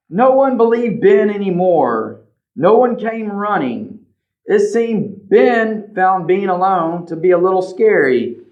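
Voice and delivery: plain reading voice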